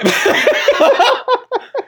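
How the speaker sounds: squealing laugh; high squealing laugh. Real, not acted. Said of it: "You're a terrible person".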